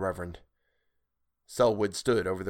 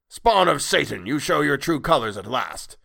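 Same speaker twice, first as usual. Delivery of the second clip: loud, raised furious denunciation; denouncing outburst; 5 semitones higher, 5 dB louder than usual. A narrator's reading. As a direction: thunder it like a raging preacher. Villain Selwood rages at creature.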